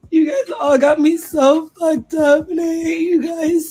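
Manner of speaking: tearful, high-pitched angry wail; crying. Real, not acted. Friend of a jailed man blaming the fans, crying. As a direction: lash out through real tears. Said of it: "I'm crying".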